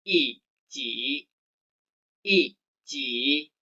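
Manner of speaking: "i, ji" with yi in a falling fourth tone; Mandarin tone change. Shown as a demonstration. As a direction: model "i, ji" with a sharp falling first syllable. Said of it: "menjadi nada empat".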